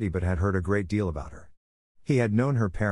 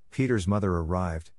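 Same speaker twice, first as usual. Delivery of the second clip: ordinary delivery, nothing marked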